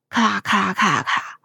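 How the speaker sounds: quick low crackle; storyteller's fast imitation of wood cracking; cracking branch. A narrator's reading. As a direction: rattle off a splintering sound. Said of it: "树枝裂开的声音".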